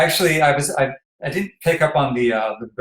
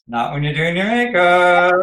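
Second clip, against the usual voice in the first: higher, drawn-out mock tearful protest; mock choked up; 5 semitones higher, 3 dB louder than usual. Interviewer retelling getting choked up during makeup, mock tearful. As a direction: stretch it out as if half-heartedly fighting back tears. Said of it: "that choked up".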